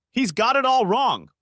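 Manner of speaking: high, slow, pleading. A narrator's reading. Daughter pleading with her angry father, tearful. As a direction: beg him to understand, near tears.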